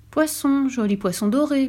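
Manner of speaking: usual speaking voice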